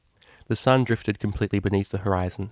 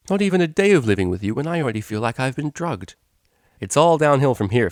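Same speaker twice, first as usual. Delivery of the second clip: laughing banter; 5 semitones higher, 5 dB louder than usual. Acted. Both ponies laughing together.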